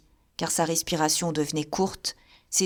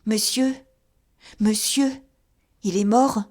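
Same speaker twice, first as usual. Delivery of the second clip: anxious plea; 3 semitones higher, 4 dB louder than usual. Acted. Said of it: "dit Gervaise, les mains jointes".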